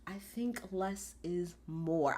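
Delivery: low, slow, unbothered; deadpan calm Damian. Retold or imitated. Damian Priest calmly answering Rhea's rambling, slow and low. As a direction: say it like a calm wall. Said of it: "He said".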